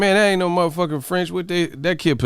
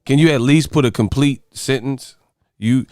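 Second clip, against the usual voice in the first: usual speaking voice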